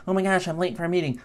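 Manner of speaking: high, fast mock panic; hurried, fretful little quote; flustered rushing. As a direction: rattle it off high, as if running late. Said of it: "briskly walking everywhere like".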